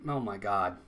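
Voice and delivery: hushed groan at the mistake; stunned quiet. Real, not acted. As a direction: drop the voice in disbelief. Quiet disbelief after error.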